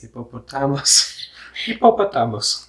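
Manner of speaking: clumsy Filipino accent attempt; fumbling into a Filipino accent; switching accents clumsily. Shown as a demonstration. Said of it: "pronounce the word in Filipino accent".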